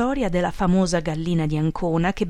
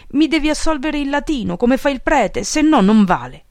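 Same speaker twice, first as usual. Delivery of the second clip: higher, louder demanding voice; stubborn insistence; 7 semitones higher, 6 dB louder than usual. A narrator's reading. Follower demands absolution in Latin from his boss, insistent. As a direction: insist on it like a big stubborn simpleton.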